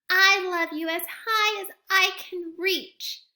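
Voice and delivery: high, loud little hare stretching up; straining upward, eager and small; little hare boasting as high as he can reach. Acted.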